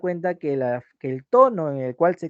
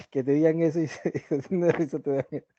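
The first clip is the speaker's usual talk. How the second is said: laughing; faster than usual. Real, not acted. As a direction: talk through real laughter. Laughing at her own example. (hablando entre risas reales)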